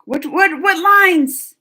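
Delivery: loud, high-pitched demand to know; urgent question. Real, not acted. Streamer desperate to hear the last lines, urgent. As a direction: burst out asking, voice jumping up.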